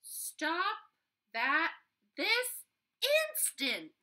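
high, loud scolding mother's cry; fed-up mom yelling at her son. Acted. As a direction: call it out like an exasperated mom.

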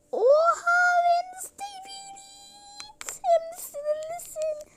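high squealing gasp of delight. Acted. Character gasps high with delight at Wednesday.

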